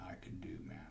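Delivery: breathy hush, nothing left to do; hushed whisper. Retold or imitated. Telling the congregation of the crash, a hushed whisper. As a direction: whisper it, helpless and ashamed.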